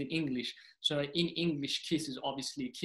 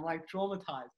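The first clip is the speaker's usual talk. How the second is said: ordinary talk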